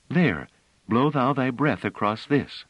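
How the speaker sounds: a hint of a tender musician; kindly woman. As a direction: answer a child kindly. Musician offering flute.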